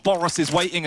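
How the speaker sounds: ordinary delivery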